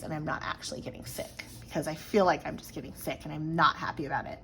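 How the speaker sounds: breathy unhappy whisper. Real, not acted. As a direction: whisper it, fed up. Worried she's getting sick.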